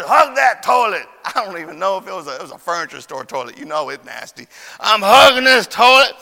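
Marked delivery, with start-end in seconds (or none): 0.0-1.1 loud, high, drawn-out order; imitated parental command; commanding mama. Mama orders punishment in story. 4.8-6.1 very loud, high mock wail; hammed-up cry from the toilet; yelping child. Acting out the child's punishment.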